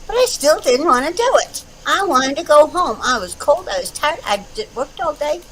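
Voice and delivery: mock sulky protest; whiny complaining. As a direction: grumble like you're dragging your feet. Complaining about the cold concert trip.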